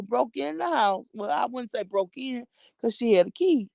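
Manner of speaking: ordinary talk, nothing marked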